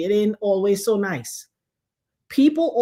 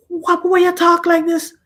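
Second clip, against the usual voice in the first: high, loud, agitated mimicry; raised, exasperated mock complaint; baffled husband; 8 semitones higher, 5 dB louder than usual. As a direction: imitate a flustered husband demanding why. Husband baffled by her quiet talk.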